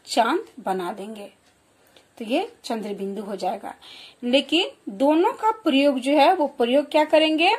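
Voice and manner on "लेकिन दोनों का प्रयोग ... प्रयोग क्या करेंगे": ordinary talk, nothing marked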